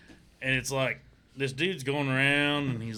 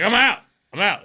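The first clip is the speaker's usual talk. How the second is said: much higher, louder quitting cry; panicked shout; 8 semitones higher, 13 dB louder than usual. Retold or imitated. Imitating the contestant fleeing the island, loud panic. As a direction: yell that you're done. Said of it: "He's like".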